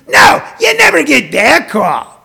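high, drawn-out comic shout; worked-up protest, slow and high; exasperated refusal. Retold or imitated. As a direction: holler it in mock indignation. Comic rant about thankless calls.

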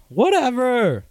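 squeaky, very high fake nonchalance; little high voice. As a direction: pitch it absurdly high and stretch it out. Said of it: "I do that little voice thing".

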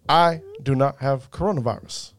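deliberate presidential delivery full of pauses; measured politician addressing the nation; Barack Obama. Acted. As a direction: weigh each word, with long pauses. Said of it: "I have a Barack Obama".

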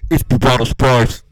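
low, loud, slow slogan in a put-on voice; alternative jingle take. Shown as a demonstration. Host trying another slogan version, low loud character voice. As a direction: drop down and push each word out heavily. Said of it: "What about this?".

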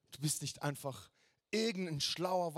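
ordinary delivery

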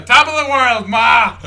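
loud triumphant yell; shouted movie quote. Pretend. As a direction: belt it out like Cagney.

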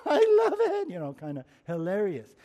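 laughing through the words, pitch jumping high and loud; delighted laugh breaking into speech; giggling over the joke. Real, not acted. Laughing at word hilarious.

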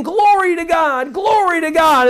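high exultant preacher shout. Retold or imitated. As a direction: proclaim it like a revival leader. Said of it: "and shouting".

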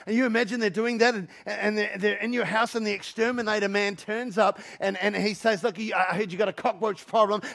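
fast, high, loud preaching; a preacher in full flow acting out the exterminator visit; preaching at full force. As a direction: hammer the story out to the crowd. Acted exterminator story, preached.

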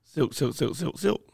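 one word rattled off five times in a row; quick-fire repeats, lifting at the end; fast repetition. Shown as a demonstration. Friend answers a challenge to repeat a word, fast repetition. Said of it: "say silk five times fast".

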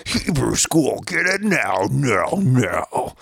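slow booming rally announcer; monster truck ad. Acted. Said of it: "that rally voice".

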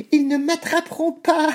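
very high, squeaky boast; gleeful gingerbread man. Acted. Gingerbread man looks back at his pursuers, high gleeful voice. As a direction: crow in a tiny voice, tipping into laughter.

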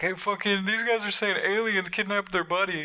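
lightly voiced excited newsman; mock local reporter. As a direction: play a small-town paper hyping a scoop. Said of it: "So, like".

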